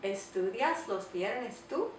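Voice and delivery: slow "Estudias los viernes tú" rising on the final "tú"; question intonation. Shown as a demonstration. Teacher showing pronoun at the end, rising question intonation. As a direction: ask "Estudias los viernes tú" slowly, lifting "tú". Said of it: "put the pronoun at the end of the sentence".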